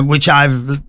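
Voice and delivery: normal talking voice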